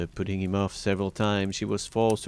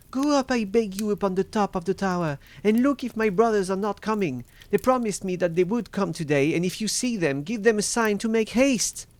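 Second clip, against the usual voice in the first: high, louder, desperate entreaty; urgent plea; 12 semitones higher, 5 dB louder than usual. A narrator's reading. Wife sending her sister up the tower for rescue, high urgent pleading.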